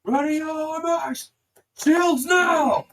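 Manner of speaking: high, very loud battle order; full-voiced officer rallying troops; commander's shout. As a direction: shout orders down the front line. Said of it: "soldiers in command begin to shout".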